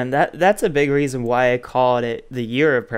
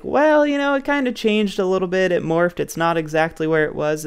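hesitant sheepish admission; 7 semitones higher than usual. Retold or imitated. Imagined friend asked a year later about their passion, sheepish higher hedging. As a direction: hedge it like you're caught off guard, voice jumping up. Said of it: "you're gonna be like".

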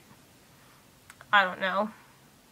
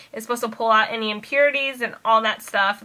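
usual speaking voice

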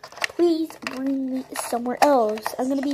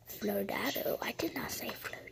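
whispered protest; 4 semitones lower, 15 dB quieter, faster than usual. Pretend. Passenger protesting under her breath.

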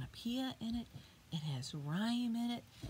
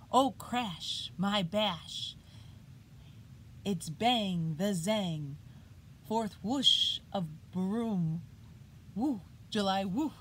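booming sound words, louder, each one hit hard; loud reading; 5 dB louder, much slower than usual. Shown as a demonstration. Teacher rereads fireworks poem to class, loud and punchy. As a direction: read the bangs like fireworks going off. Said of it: "Let me read it again".

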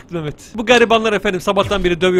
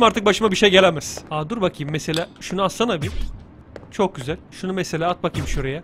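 normal talking voice

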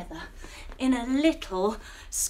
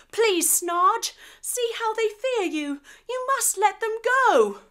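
brave plea; 9 semitones higher, 4 dB louder than usual. Acted. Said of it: "stands up tall and brave".

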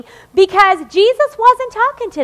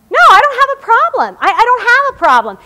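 higher, quick, flustered insisting; defensive denial; 4 semitones higher, faster than usual. Retold or imitated. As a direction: blurt out a nervous denial. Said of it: "and you're like".

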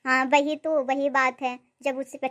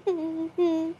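a short gloating laugh. Acted. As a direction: laugh like the rival who just won. Rival gloats after she leaves. (विजयी ताना भरी हँसी)

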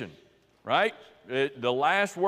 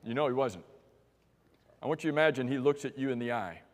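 ordinary delivery

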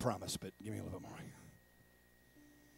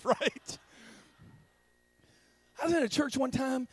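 usual speaking voice

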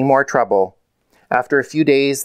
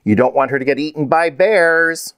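higher, climbing cautionary tone; warning; 6 semitones higher than usual. A narrator's reading. Mama warns the girls about bears, cautioning. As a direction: caution them with a lifted voice. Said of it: "warned Mama".

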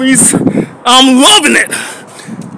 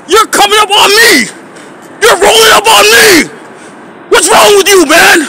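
real angry shouting; 6 semitones higher than usual. Real, not acted. Venting to viewers about the guard, furious shout. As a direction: yell it, voice cracking with anger.

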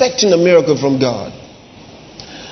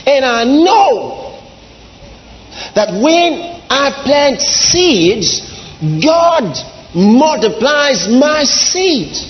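farmer's quote; 7 semitones higher, much slower than usual. Acted. Preacher voicing farmer's quote.

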